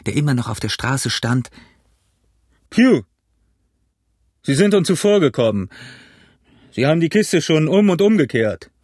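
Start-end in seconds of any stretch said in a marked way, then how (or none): 2.7-8.7 raised, higher-pitched shout from a window; high hail to someone below; calling down. A narrator's reading. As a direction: call the news down to the street. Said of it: "Er rief zu dem blinden Bettler herunter".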